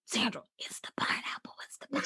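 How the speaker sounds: unvoiced hushed warning; urgent secret whisper. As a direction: whisper it covertly to your friend. Secretly warns friend beside stranger.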